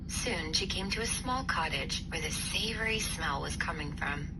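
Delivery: ordinary delivery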